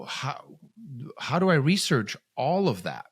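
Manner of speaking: a father flustered by a list of diagnoses, reliving it; overwhelmed, head spinning. Retold or imitated. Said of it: "my head's spinning".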